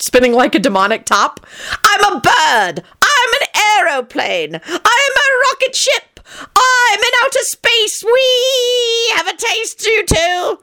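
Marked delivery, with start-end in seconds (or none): 1.8-10.6 very high, slow, giddy near-shrieking; giddy euphoria. A narrator's reading. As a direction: float away in mad delight. John high on potion.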